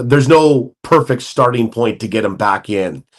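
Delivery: ordinary delivery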